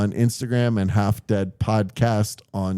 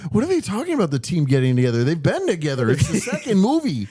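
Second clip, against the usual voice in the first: a light put-on Canadian accent, raised pitch; 7 semitones higher, faster than usual. Host imitates a confused Canadian fan, light Canadian accent. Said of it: "I gave him a Canadian accent".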